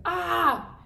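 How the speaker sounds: short high, loud yell; sudden mock scream; imitated scream. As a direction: mimic a startled friend's scream.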